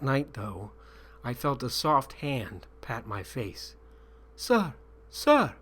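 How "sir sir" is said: lightly voiced child, higher; young voice quietly. A narrator's reading. A young boy gently waking the captive, quietly. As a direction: call softly like a young boy. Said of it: "a young voice said quietly".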